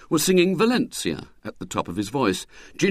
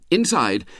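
ordinary delivery, nothing marked

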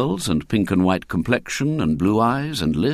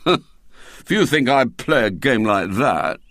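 slow, haughty schoolboy disdain; cold refusal; 4 semitones higher, 3 dB louder than usual. A narrator's reading. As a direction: refuse him with wounded dignity. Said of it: "he began coldly".

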